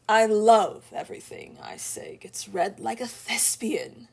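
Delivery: hammed-up stage actor reading; mock thespian. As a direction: declaim it playfully, leaning hard on the opening. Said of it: "Thespian. The accent".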